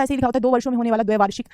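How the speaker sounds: ordinary talk, nothing marked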